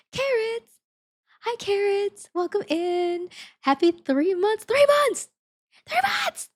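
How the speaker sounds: high-pitched excited cheer; excited greeting. Real, not acted. Streamer welcomes Carrots for three months, excited squeal. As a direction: build to a squealed celebration.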